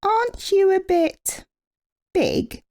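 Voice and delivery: high, doubtful little bee; Amelia voice. A narrator's reading. Amelia questioning Norman's size, high and suspicious. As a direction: probe him like a clever little bee. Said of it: "asked Amelia".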